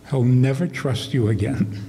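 ordinary talk, nothing marked

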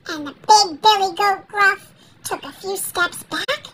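ordinary delivery, nothing marked

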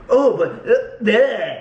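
high goofy awkward voice; acting weird. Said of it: "acting weird".

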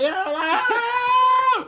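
high, loud panicked scream; freaking out scream. Retold or imitated. Little kid screaming as a camel grabs his hair. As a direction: shriek like a scared little kid. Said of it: "I was freaking the fuck out".

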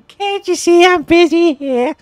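high, loud, slow mock whine; hammed-up squeaky mimicry; mimicking game character. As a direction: parrot the busy worker, high and exaggerated.

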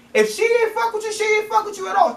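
high-pitched, incredulous scolding; exasperated scolding. Real, not acted. Telling a man she's not into him, high and exasperated.

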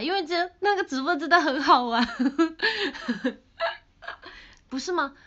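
bubbling over with glee; laughing excitedly. Real, not acted. Streamer telling fans today's stream was fun, laughing.

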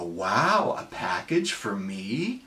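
low, soft fake surprise; acting coy. Husband feigning ignorance to his wife, mock coy. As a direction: play innocent, quiet and slow. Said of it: "you act all coy".

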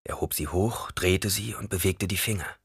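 ordinary delivery, nothing marked

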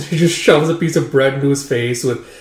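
ordinary delivery

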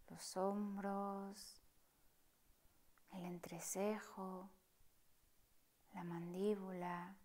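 soft, partly whispered meditation voice; hushed calm guide inviting stillness; guided meditation. Acted. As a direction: guide them gently through the breath, unhurried. Leads a breathing meditation.

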